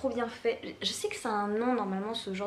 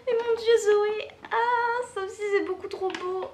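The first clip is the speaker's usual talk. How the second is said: high, loud, laughing gush; real squealing delight, drawn out; overjoyed amazement; 10 semitones higher, 5 dB louder than usual. Real, not acted. Opening another drawing from a friend, overjoyed.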